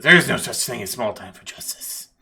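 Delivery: loud, over-dramatic detective; impassioned lawman's declaration; righteous detective. Acted. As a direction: proclaim it as a crusader for justice. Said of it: "the detective goes".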